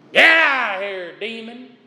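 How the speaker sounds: usual speaking voice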